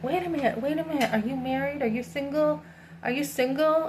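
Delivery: incredulous questioning. Retold or imitated. Woman retelling a guy's nosy questions, incredulous.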